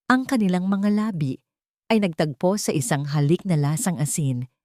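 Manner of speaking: plain reading voice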